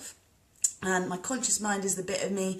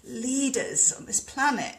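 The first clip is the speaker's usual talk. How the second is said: usual speaking voice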